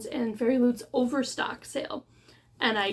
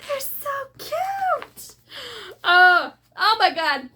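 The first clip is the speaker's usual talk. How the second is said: much higher, slow gush building to a loud cry; gush, then burst out louder; delighted squeal; 10 semitones higher, 7 dB louder, slower than usual. Real, not acted. Swooning over cute end papers, building to a loud squeal.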